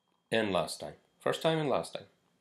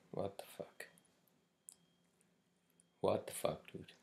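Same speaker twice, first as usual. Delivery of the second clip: exasperated whisper; 8 dB quieter than usual. Real, not acted. Ranting about hurricanes.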